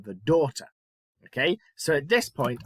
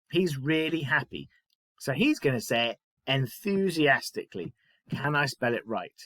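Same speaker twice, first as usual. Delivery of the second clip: ordinary delivery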